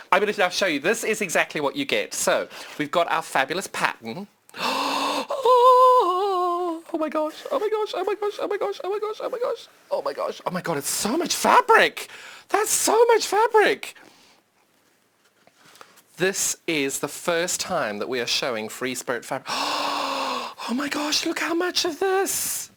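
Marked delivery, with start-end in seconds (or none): 4.5-6.9 excited gasp. Real, not acted. Unboxing the fabric. 6.9-14.0 high, fast excited squeals; squealing with excitement. Real, not acted. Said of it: "Sorry, I'm very excited about this". 19.5-22.7 gasp, then a high squeal; excited gasp and squeal. Real, not acted. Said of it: "I'm very excited about this".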